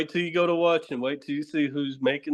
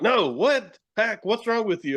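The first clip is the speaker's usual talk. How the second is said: higher-pitched, mildly exasperated scolding; mock exasperated director; 7 semitones higher than usual. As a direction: imitate a director losing patience. Voices exasperated director correcting him.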